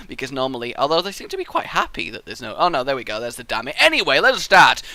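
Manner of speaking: usual speaking voice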